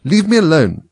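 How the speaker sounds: high indignant protest. A narrator's reading. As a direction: push the pitch up in affront. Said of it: "said Alice indignantly".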